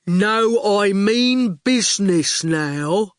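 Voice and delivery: slow, self-satisfied goon; rather smug. A narrator's reading. Goon tells them he means business, slow and smug. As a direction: say it slow and pleased with himself. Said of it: "He sounded rather smug".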